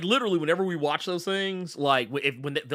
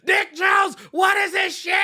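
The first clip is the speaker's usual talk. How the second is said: high, loud panicked begging; hammed-up shout of a villain pleading for his life; Clarence; 13 semitones higher, 6 dB louder than usual. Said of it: "literally begging".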